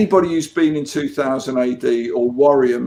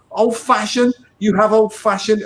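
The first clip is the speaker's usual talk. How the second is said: high-pitched astonished questioning; mock amazement, voice jumping high; incredulous quote; 8 semitones higher than usual. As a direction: quote him in amazed disbelief, pitched right up. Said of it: "He went".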